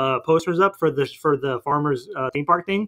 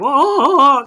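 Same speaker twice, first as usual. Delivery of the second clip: high, loud alien squealing; 10 semitones higher, 6 dB louder than usual. Retold or imitated. Retelling a film to a friend, squealing like the alien. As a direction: squeal it like a startled little alien. Alien voiced in retold movie.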